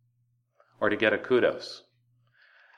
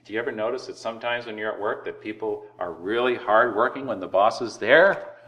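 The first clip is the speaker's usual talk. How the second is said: ordinary talk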